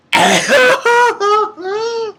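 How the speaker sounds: high-pitched, wailing laugh; loud excited laugh. Real, not acted. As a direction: burst into loud high cackling. Laughs after quoting the threat.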